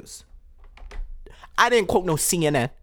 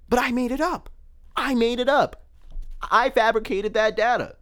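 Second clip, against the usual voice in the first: higher, worked-up outrage; rising excitement over fabricated data; getting excited; 9 semitones higher than usual. Real, not acted. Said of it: "I'm getting real excited here".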